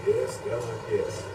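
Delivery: ordinary talk, nothing marked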